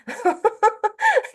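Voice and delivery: short high laugh. Real, not acted. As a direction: break into a quick high laugh. Laughs at own joke.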